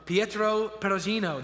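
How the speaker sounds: hammed-up Italian accent on Pietro Perugino. Shown as a demonstration. Preacher tries an Italian accent on a painter's name for the congregation. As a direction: roll the Italian name out like an Italian, a bit overdone. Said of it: "I'm gonna try to do this Italian name".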